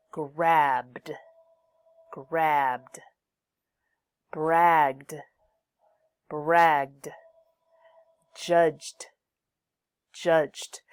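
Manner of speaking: "Grabbed", "bragged", "judged" loud and isolated, each ending in D; final D sound. Shown as a demonstration. Teacher drills voiced endings for students, "Grabbed" to "judged" closing on D. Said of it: "Use the D sound after voiced final sounds".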